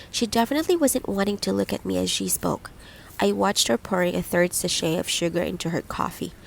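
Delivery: ordinary delivery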